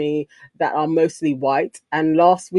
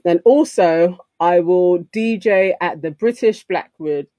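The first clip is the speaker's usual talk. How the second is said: ordinary talk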